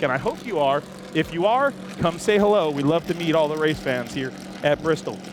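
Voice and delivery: ordinary delivery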